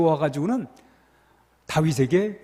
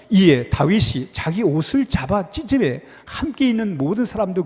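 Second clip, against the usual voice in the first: a touch louder, measured scripture read; 4 dB louder than usual. Acted. Pastor leading the congregation in reading scripture, measured read. Reads scripture aloud together.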